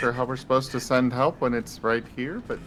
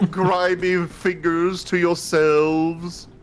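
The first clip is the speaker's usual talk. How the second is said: posh voice; 7 semitones higher, 7 dB louder, slower than usual. Retold or imitated. Continues posh snob bit.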